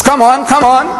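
high, jeering come on come on; mocking taunt. The reverend, stamping his feet at the Maulana, taunts him. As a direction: goad him, sure he has won.

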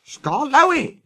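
high, surprised exclamation; exclaimed. Acted. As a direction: cry the name in delighted astonishment. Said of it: "he exclaimed".

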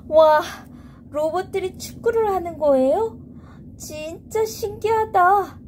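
high, wide-eyed wonder; amazed child. Acted.

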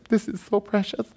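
hammed-up tender swoon; mock feminine gushing. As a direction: gush over it in a small high voice. Gushing, says he's not a woman.

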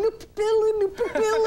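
high-pitched mock distress call; retold screaming. Stranded boater signalling to people on shore, high mock yelling. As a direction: reenact calling out from the boat in mock panic. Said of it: "we heard him screaming and screaming".

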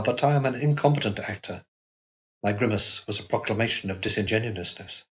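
ordinary delivery, nothing marked

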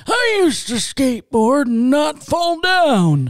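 put-on slow, high, loud old-man voice. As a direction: put on an old codger reminiscing. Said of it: "one day you're gonna talk like that".

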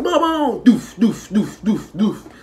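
high, loud, laughing booyah-style boom and doof noises; hammed-up Jamaican noises, up high with a laugh; mock Jamaican sounds. Mocking how Zoes sound, hammed-up high noises with a laugh. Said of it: "Them Zoas be like".

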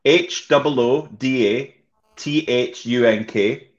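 slow, letter by letter spelling; spelling slowly. Shown as a demonstration. Asked to spell his handle, spells it out slowly. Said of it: "Spell it".

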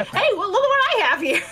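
gleeful character voice. Acted. Hades reveals hidden cover.

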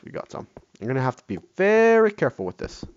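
usual speaking voice

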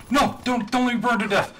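high, urgent plea not to die; panicked pleading. Real, not acted. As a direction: beg in a panic, pitch up. Panicking about dying mid-streak.

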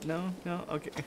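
ordinary talk, nothing marked

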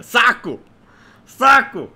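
loud annoyed outburst; frustrated shout. Real, not acted. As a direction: snap out in frustration. Frustrated at game.

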